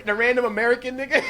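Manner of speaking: fast, laughing callout. Real, not acted. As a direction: blurt it out laughing.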